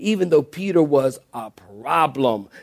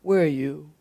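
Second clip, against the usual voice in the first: softer, gentle asking; soft, tender call; 5 dB quieter than usual. Shown as a demonstration. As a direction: ask softly like a caring father. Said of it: "Or did he say".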